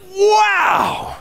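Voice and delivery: very high, delighted "Wow"; a wide-eyed, thrilled "Wow"; amazed wow. Shown as a demonstration. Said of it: "would he be like".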